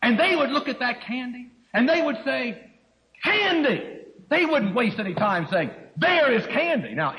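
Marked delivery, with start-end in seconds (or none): 3.2-4.0 put-on high-pitched excited cry of a child; emphatic exclamation. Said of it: "they were emphasizing". 6.0-6.9 higher, mock-excited child's remark; acted exclamation. Voicing his small girls' reaction.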